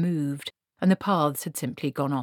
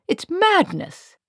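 much higher, slow exclamation; appalled protest; 13 semitones higher, 5 dB louder, slower than usual. Acted. Wizard objecting to boating in a storm, appalled. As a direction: exclaim it, drawn out and louder.

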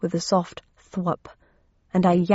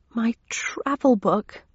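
horrified whisper; 4 semitones higher, slower than usual. Acted. Dismayed realization of loss.

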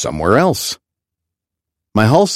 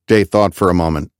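ordinary delivery, nothing marked

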